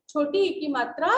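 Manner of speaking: ordinary talk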